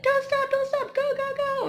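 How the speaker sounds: high, loud mock crowd yelling; imitating spectators yelling encouragement; cheering spectators. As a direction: shout it like a crowd on the roadside. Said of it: "yelling at you".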